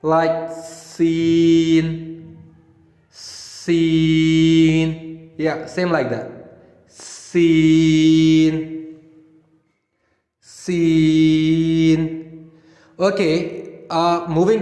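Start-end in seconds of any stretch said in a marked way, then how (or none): 0.0-5.3 seen with a long hissing whistled s. Shown as a demonstration. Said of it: "make a whistle sound". 6.9-12.5 seen with a long hissing whistled s. Shown as a demonstration. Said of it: "make a whistle sound".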